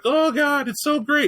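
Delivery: mock gushing. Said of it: "You're like".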